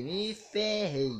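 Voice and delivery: slow-motion "me ferrei" of doom; drawn out. Retold or imitated. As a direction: stretch each syllable of "me ferrei". Repete a frase, agora arrastada.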